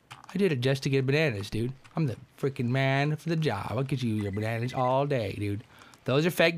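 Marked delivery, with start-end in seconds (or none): none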